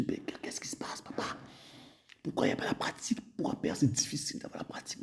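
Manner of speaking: soft unvoiced plea to father; whispered prayer to God. Believer quietly asking the heavenly father, whispering. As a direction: whisper it like a bewildered child praying. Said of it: "On dit".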